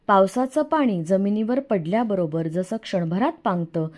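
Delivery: ordinary delivery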